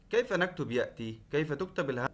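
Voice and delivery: ordinary talk, nothing marked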